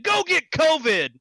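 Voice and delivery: high, loud, slow jeer; taunting shout. Retold or imitated. Speaker taunting the old man from the car, loud slow jeer. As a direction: yell it to provoke him.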